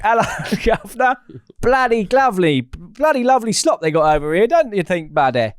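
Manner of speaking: high, loud Cockney chimney sweep; hammy Victorian Cockney, up high and loud. Pretend. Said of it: "sort of Victorian, Chimney sweep".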